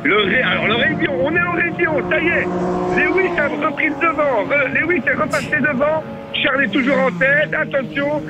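excited sports commentator calling a toy race; race commentary. Acted. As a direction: call the race as it happens. Live race play-by-play.

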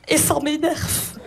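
slow, simmering Belgian irritation; annoyed Belgian accent. Acted. Comedian getting annoyed, Belgian accent returning. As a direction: draw it out with the Belgian accent creeping in. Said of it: "mon accent belge revient très fort".

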